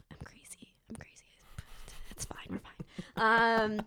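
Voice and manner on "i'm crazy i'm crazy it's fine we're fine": whispered self-mocking aside; a whispered joke about being crazy. Real, not acted. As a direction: whisper it to yourself with a grin. Joking aside about herself.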